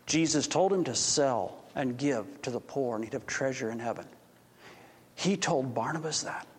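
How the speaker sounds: hushed telling. Acted. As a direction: pass the news on quietly. Messenger tells the story hushed.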